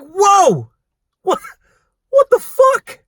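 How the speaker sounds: high, loud disbelieving exclamation; shocked outburst. Retold or imitated. Worker reacting to customers' put-down, shocked and loud. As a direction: burst out in loud, high-pitched outrage.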